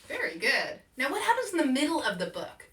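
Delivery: normal talking voice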